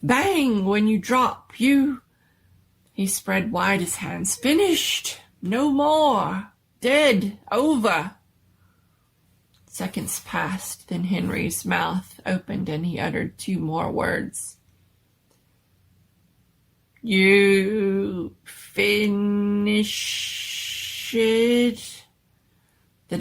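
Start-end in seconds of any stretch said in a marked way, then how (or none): 0.0-8.2 higher, louder, slow and emphatic; the doctor mimes danger to Henry, loud and slow; slow simple words. Acted. Doctor speaks simply to monster. 17.0-22.0 very slow, louder, halting creature; Henry the monster, halting and slow. Acted. Monster Henry speaks first words.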